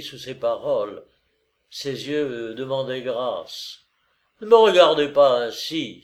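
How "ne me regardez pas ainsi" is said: a louder exclamation; exclaiming. A narrator's reading. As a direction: cry out to stop her stare. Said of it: "s'écria-t-il". (s'écrier plus fort)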